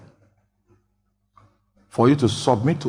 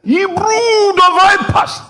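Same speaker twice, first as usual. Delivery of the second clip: high, slow, loud fiery rebuke; preaching shout; 5 dB louder, slower than usual. Retold or imitated. Preacher re-enacting a fiery rebuke of the crowd, high and loud. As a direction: thunder it like a prophet condemning. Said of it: "That was how he preached".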